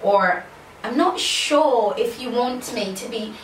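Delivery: put-on British English, drawn-out words; British accent. Shown as a demonstration. Vlogger showing off for viewers, British accent. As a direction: demonstrate a slow British accent. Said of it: "American accent or British accent".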